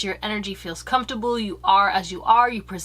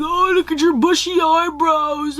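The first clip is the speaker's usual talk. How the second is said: high, loud taunting voice; mocking teaser; 7 semitones higher, 5 dB louder than usual. Mocking people who teased your eyebrows, high taunting voice. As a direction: sneer like a schoolyard bully.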